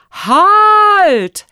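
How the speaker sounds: high, drawn-out loud command; shouted halt. A narrator's reading. Rector stopping everyone in the school hall, shouting. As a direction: call a halt at full voice. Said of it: "rief er".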